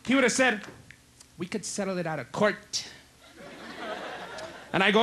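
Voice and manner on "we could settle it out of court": soft, lower suggestive voice. Acted. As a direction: proposition it quietly, mock seductive.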